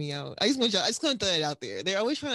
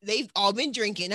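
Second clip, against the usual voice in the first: ordinary talk, nothing marked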